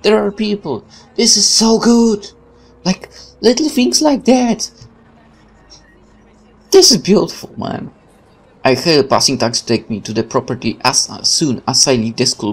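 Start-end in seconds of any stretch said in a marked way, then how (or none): 0.0-7.9 higher, delighted gushing; excited gush. Real, not acted. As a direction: rave about the little details. Delighted by game background crowds.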